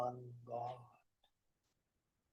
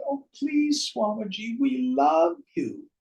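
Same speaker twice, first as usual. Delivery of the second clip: pleading, pitched well up and a little louder; hammed-up plea from a devoted follower; begging devotee; 11 semitones higher, 3 dB louder than usual. Devotee begging the dying teacher to stay, high pleading voice. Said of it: "And he said".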